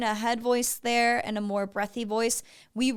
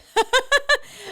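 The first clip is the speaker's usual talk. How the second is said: high delighted laugh; real laugh; burst of laughter; 13 semitones higher, 7 dB louder than usual. Real, not acted. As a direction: laugh out loud, amused. Laughing at Tennessee in song.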